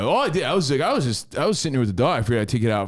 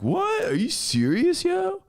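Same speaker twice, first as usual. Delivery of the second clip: much higher, incredulous "What?"; imitating stunned disbelief; slower than usual. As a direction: jump way up in pitch, hammed-up shock. Brother voiced, stunned by complaint.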